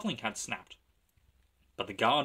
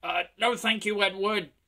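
engine calling back; 9 semitones higher, 5 dB louder than usual. A narrator's reading. James calling back to Edward.

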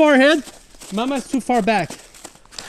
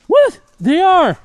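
surprised; 10 semitones higher, 5 dB louder, much slower than usual. Real, not acted. Surprised at bugs in snow.

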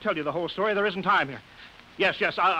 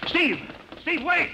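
calling out; 10 semitones higher, slower than usual. Acted. Calling after fleeing man.

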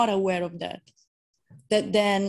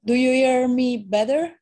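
speaking up, louder and clearer; 5 semitones higher, 6 dB louder than usual. Real, not acted. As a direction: raise the voice so they hear. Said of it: "could you speak a bit louder".

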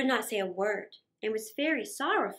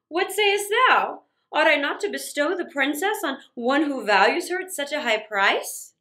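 royal Sultan; 5 dB louder than usual. Acted. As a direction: weigh the question like a proud king. Sultan asks vizier.

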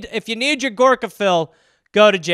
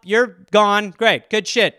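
normal talking voice

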